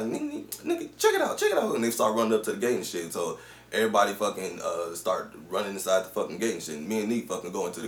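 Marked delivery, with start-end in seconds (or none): 0.0-1.7 high, squeaky mocking voice; mock strangers. Men getting out of the car, mocked in a high voice. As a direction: caricature them backing down way up high.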